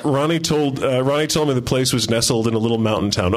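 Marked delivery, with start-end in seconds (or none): none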